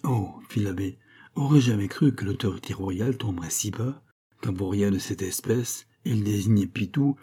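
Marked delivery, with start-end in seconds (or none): none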